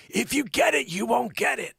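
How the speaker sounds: high, put-on Fauci voice; Fauci impression. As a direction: do Doctor Weasel Fauci. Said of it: "the Doctor Weasel Fauci stuff".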